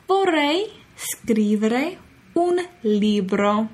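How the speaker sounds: each word drawn out for learners; very slow model phrase. Shown as a demonstration. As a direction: say it slowly for students to echo.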